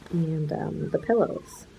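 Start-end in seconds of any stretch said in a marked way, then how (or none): none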